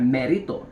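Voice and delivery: "Merito" with Spanish-style sounds, lifted at the end; Spanish-style name pronunciation. Shown as a demonstration. Host telling viewers to copy it, demonstrative Spanish-style "Merito". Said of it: "You gotta say it like that".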